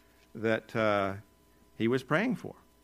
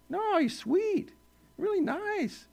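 much higher, slow, syrupy-sweet mock voice; slower than usual. Preacher mocking the harmless view of Jesus, sugary high coo. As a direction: coo it like a greeting card Jesus.

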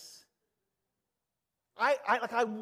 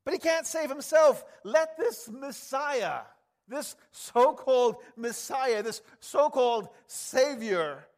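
loud, slow sneering taunt, higher throughout; mocking jeer; 6 semitones higher, 9 dB louder than usual. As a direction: jeer up at the man on the cross. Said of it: "They're saying".

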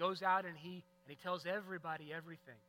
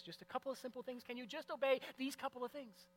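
lightly voiced parent, pitched up, pleading with the kids; a hint of an exasperated parent's plea; 8 semitones higher than usual. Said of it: "parents, you're familiar with this with your kiddos".

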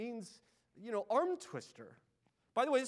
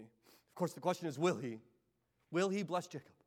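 ordinary delivery

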